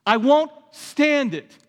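hammed-up angry father-style rebuke; slow, loud, biting at the end; vengeful scolding. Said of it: "a vengeful response".

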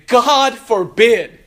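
loud, high, drawn-out exclamation; emphatic denial. Acted. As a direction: punch out each word slowly as a firm denial. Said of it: "It is an emphatic denial".

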